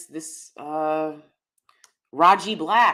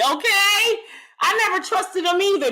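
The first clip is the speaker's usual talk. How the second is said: ordinary talk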